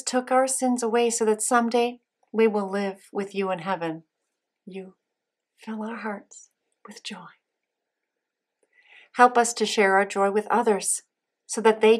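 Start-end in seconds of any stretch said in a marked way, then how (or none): 4.6-7.3 soft whispered prayer; whispered prayer response. Acted. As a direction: breathe the response softly.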